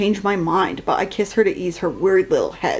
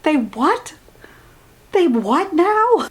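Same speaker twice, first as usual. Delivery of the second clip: high disbelieving rise; stunned what now; incredulous; 8 semitones higher, slower than usual. Real, not acted. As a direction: drag out the confused disbelief. Reacting to "my ovaries buckle.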